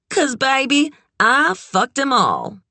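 high, proud character voice; brassy boast. A narrator's reading. Red boasts to Kit about men, brassy and unashamed.

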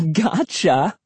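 high, teasing cry; gleeful cry. A narrator's reading. Emily teasing after her fake push, gleeful cry. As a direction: crow it as a prank that worked. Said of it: "she cried".

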